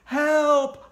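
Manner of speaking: high, loud cry for help; shouting. Acted. Kid calling for help against ghosts, shouting. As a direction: yell it at full force. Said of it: "I shouted".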